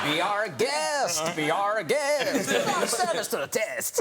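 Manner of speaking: slow, exaggerated Mexican-accented show tune; French accent gone totally Mexican. Shown as a demonstration. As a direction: stretch it out in a hammed-up Mexican accent. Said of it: "you suddenly become totally Mexican".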